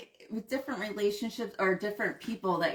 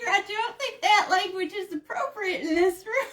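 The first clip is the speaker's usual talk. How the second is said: put-on high, prissy old lady scolding; tut-tut like a proper grandmother; prim grandma voice; 11 semitones higher than usual. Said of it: "Shut up, Grandma".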